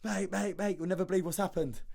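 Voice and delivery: high, rushed retelling of an excited call; excited phone call to a mate, voice jumping high. Retold or imitated. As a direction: pitch it up like you can't wait to tell. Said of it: "the excitement in my voice".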